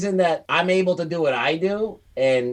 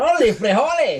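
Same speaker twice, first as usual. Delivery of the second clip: hammed-up Mexican accent, pitched high; mock Mexican accent; 8 semitones higher, 3 dB louder than usual. Mocking the botched accent as Mexican.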